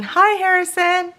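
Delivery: called-out greeting. Pretend. Speaker greets the shop owner's son on camera, high called-out greeting. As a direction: sing out a friendly hi.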